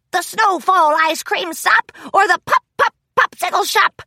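high, loud gruff pirate; pirate bird voice. Acted. Pirate bird Mullet asks.

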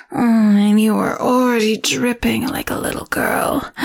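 menacing temptress toying with her captive; sultry seductress. Acted. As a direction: purr each threat like a caress. Seductive captor teases hero.

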